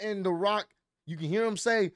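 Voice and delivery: normal talking voice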